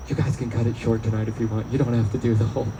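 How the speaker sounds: low, subdued voice; soft, low mock reassurance; imagined low-key aside. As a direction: say it quietly, down in your register. Imagines the guy's reaction.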